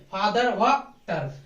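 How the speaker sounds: फादर व टर drawn out slowly, syllable by syllable; syllable split. Shown as a demonstration. Teacher splitting the word for students, slow syllable by syllable. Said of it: "फा plus दर".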